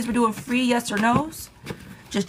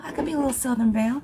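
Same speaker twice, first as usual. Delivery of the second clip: higher, quick Southern Belle lilt; Southern Belle accent; 5 semitones higher, faster than usual. Shown as a demonstration. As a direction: play a little Southern Belle. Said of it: "I can do a Southern Belle".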